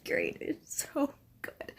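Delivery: slow, hushed swoon; whispered swoon; breathless whisper. Real, not acted. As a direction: sigh it out softly. Swooning over the couple.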